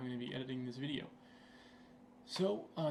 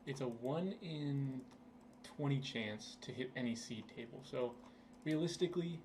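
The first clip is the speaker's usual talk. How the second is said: ordinary talk, nothing marked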